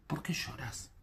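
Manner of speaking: very soft, half-whispered question; gentle hushed question. Acted. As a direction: ask it tenderly, almost under the breath. Angels' question in scripture reading.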